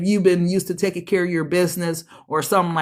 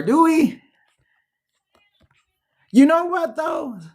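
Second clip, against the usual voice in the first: surprised high exclamation; 8 semitones higher than usual. Real, not acted. Surprised reaction to card.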